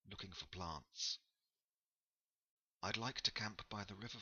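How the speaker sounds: polite traveller explaining herself; lightly voiced Ingrid.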